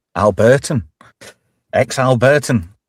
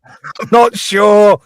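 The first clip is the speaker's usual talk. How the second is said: put-on voice; 9 semitones higher, 4 dB louder, much slower than usual. Carries on the put-on voice.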